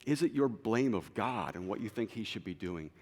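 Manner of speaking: ordinary talk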